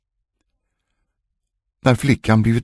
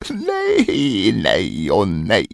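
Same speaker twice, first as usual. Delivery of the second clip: laughing dwarf; much slower than usual. Acted. Dwarf laughs at the queen's failed guesses, gloating slowly. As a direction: crow it slowly, mocking her. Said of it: "hoppade och skrattade".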